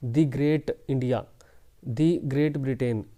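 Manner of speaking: slow demo with The said as a long "dee"; The drawn out as "dee" before Great. Shown as a demonstration. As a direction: say The as "dee" at the start, slowly. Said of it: "उसको हम दी बोलते हैं".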